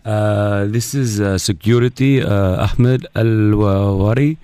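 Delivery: put-on halting, accented official on the phone; put-on foreign security officer. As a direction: pose as a hesitant foreign security man. Prank caller poses as security.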